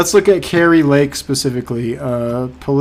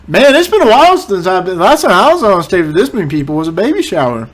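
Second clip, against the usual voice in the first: mocking candidate; 5 semitones higher, 5 dB louder, faster than usual. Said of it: "Listen, I haven't been on a stage".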